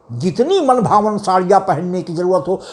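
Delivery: ordinary talk, nothing marked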